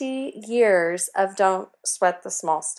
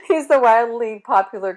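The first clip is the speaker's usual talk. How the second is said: laughing; 4 semitones higher, 8 dB louder than usual. Real, not acted. Laughing after slip.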